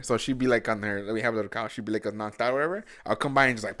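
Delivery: usual speaking voice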